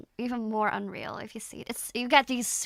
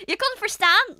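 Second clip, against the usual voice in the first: higher, louder startled question; surprised; 9 semitones higher, 10 dB louder than usual. Real, not acted. Streamer shocked a viewer understood her Dutch, surprised. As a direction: caught out in disbelief.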